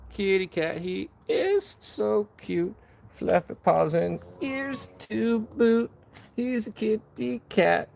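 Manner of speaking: put-on high, slow cooing baby talk; pet baby talk. Streamer gushing over his cute cat, high slow baby talk. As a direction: coo at the kitty like it's a baby.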